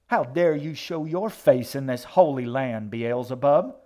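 loud, high indignant preacher; scandalized holy fury; outraged denunciation. A narrator's reading. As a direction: thunder at a devil from the pulpit. Old woman denounces the boy.

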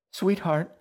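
high, loud, alarmed mother; panicked motherly call; rising panic. A narrator's reading. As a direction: call out anxiously for your child. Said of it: "the panic rose in her voice".

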